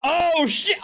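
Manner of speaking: amused alarm. Real, not acted. Reacting to crying clip.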